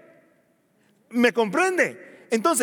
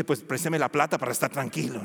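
lower, softer wry retort; sarcastic inner reply; 9 semitones lower, 5 dB quieter than usual. Retold or imitated. Anxious debtor's inner comeback, low and wry. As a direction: answer dryly under your breath. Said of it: "usted por dentro dice".

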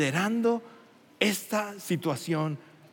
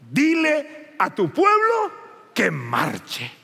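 loud, high, slow order; booming command; 8 semitones higher, 6 dB louder, much slower than usual. Acted. God ordering Moses to move the people, booming. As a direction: command it with authority.

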